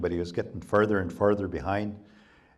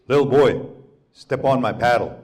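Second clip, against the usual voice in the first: giant's loud call; 4 semitones higher, 9 dB louder than usual. Acted. Giant luring the boy.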